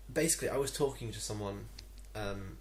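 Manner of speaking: ordinary talk, nothing marked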